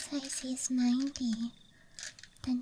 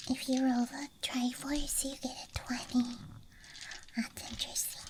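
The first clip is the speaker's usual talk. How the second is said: ASMR whisper. Real, not acted. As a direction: whisper close to the mic. ASMR dice show-and-tell.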